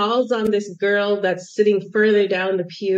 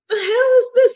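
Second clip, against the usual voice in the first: high-pitched, louder exasperated outburst; exasperated disbelief; 5 dB louder than usual. Retold or imitated. Exhausted pilgrim watching others stride past, exasperated disbelief. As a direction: exclaim it in baffled exasperation.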